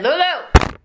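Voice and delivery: mimicked grandfatherly summons; imitated calling out. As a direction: call out to a grandchild, voice up. Grandfather calls for her in story.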